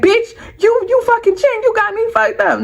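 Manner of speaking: high-pitched angry confrontation, retold; teed up, mad. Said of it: "I'm teed up. Like, I'm mad".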